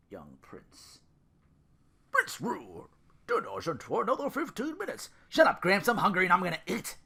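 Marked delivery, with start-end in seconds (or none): 2.1-5.1 lightly voiced high old grandpa; a hint of a fussy old man. 5.3-7.0 loud, high, fast bratty yell. Acted. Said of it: "Ru yelled".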